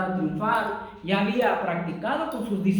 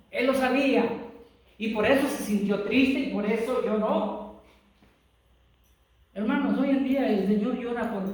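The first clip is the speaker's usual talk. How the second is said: louder, raised pitch, pressing each phrase home; fervent preaching; 3 semitones higher, 4 dB louder than usual. Acted. Pastor urging his congregation about weeping Lord, fervent raised preaching. Preacher builds to full force.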